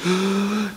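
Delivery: quick mock gasp; sharp gasp for breath. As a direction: gulp in air, mid-argument. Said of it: "taking their breath".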